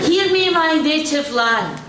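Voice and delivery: grand declaiming opener; over the top. Shown as a demonstration. Poet testing an over-the-top opening, grandly declaimed. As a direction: proclaim it like a grand bard. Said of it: "O T T? A bit".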